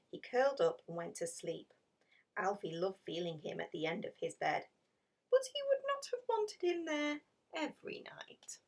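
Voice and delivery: whispered narration, as if the kitten sleeps; hushed story whisper. A narrator's reading. Reading the sleeping-kitten part to children, whispered. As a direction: keep it a whisper so nobody wakes.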